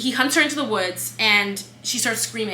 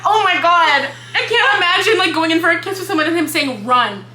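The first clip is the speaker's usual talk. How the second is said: higher and louder, acting out her own shock; mock horrified reaction; 7 semitones higher, 6 dB louder than usual. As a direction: replay the moment the fear hit her, wide-eyed. Retells her own scared reaction.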